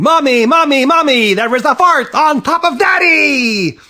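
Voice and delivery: put-on high, loud panicked child yell; shrieked alarm, stretched at the end. A narrator's reading. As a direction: scream for help from Mommy. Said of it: "So Carmen ran downstairs yelling".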